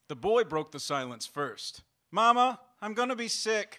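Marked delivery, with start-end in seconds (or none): 2.1-3.8 put-on high, loud little-kid call for Mama; carsick child. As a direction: call out to your mother as a queasy boy. Sick child calls to mother.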